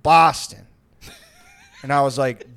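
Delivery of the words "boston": "Boston" barked back loud and flat; insistent drunk. Retold or imitated. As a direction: insist on "Boston" like a drunk who won't listen. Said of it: "He goes".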